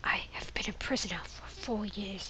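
hushed, desperate prisoner pleading; whispering prisoner. Acted. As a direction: beg for help in a whisper. Voices imprisoned man from game.

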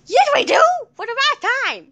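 high-pitched insistent retort; exasperated. Pretend. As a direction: argue back, fed up. Arguing about having a doctor.

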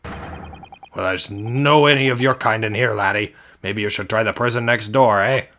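loud, dismissive Scottish governor; attempted Scottish accent. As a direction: send them packing in rough Scottish. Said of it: "I feel like he's Scottish".

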